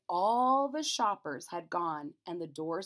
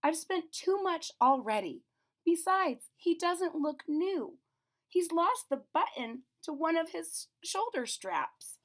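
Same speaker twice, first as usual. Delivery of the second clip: high, slow, regretful mom; lightly voiced mother, patient; 9 semitones higher, 4 dB louder than usual. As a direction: explain kindly to the child why not. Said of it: "her mother sighed".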